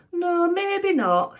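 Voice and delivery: high, slow reluctant concession; mild dad voice. Acted. Dad conceding Sapphire wasn't the one, high and slow. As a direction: drag it out, high.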